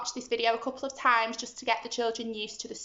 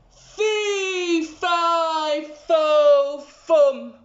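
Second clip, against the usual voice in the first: building, louder giant roar; 5 semitones higher, 7 dB louder, much slower than usual. Acted. The giant chases Jack, roaring louder. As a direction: roar each word like a giant. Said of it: "roared the giant".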